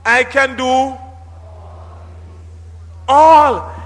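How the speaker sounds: "all." hit high and loud; drawn-out, punched "all."; stress on all. Shown as a demonstration. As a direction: lean hard on "all.". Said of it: "Pardon the stress and the emphasis".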